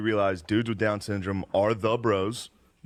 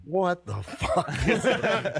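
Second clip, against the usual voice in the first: slow, laughing what the fuck; laughing mock disbelief; 7 semitones higher, much slower than usual. The room reacting to the heckler, laughing disbelief. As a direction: stretch it out through laughter. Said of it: "everyone in the room was just like".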